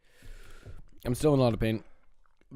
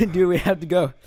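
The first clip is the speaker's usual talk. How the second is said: laughing in pain; 4 semitones higher, 8 dB louder than usual. Real, not acted. Pained reaction to spicy food.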